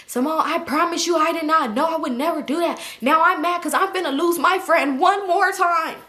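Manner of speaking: high, hammed-up protest of innocence; mock pleading. Friend swearing she didn't do it, imitated high pleading.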